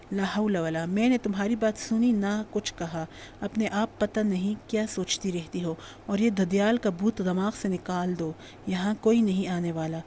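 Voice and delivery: plain reading voice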